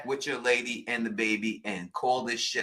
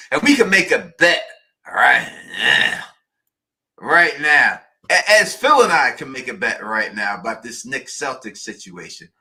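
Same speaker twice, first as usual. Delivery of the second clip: loud pitch; 8 dB louder than usual. Real, not acted. Host loudly pitching a bet on air.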